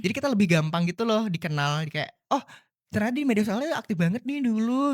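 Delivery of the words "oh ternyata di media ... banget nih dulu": higher-pitched imagined employer's reaction; mock pleasant surprise; impressed discovery. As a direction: voice someone pleasantly finding out, pitch lifted. Voicing an impressed future employer.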